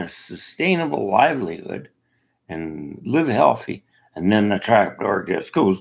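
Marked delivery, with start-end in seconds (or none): none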